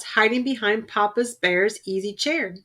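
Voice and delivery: ordinary delivery, nothing marked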